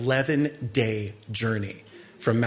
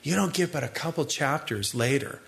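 ordinary talk

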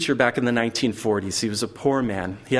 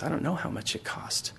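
subdued reply; 8 dB quieter than usual. Acted. Car owner answering the kid's question, quiet and subdued. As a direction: answer quietly, almost under your breath. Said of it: "The guy goes".

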